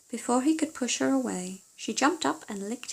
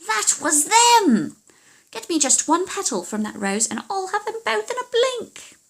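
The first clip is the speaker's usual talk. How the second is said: shrieking witch fury; furious witch; 10 semitones higher, 7 dB louder than usual. Acted. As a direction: explode with rage at her daughter. Witch shouts at daughter.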